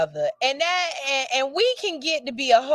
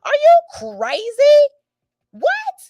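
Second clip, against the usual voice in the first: indignant husband; 2 semitones higher, 6 dB louder than usual. Acted. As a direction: play the husband, offended and shouting. Acts the offended husband.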